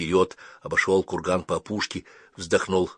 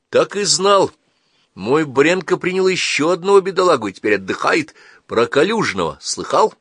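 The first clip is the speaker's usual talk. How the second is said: put-on higher, louder old wanderer; chatty, raised storyteller character; 9 semitones higher, 5 dB louder than usual. Калик вернулся, рассказывает. (голос старого странника, громче и выше)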